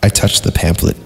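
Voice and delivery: ordinary delivery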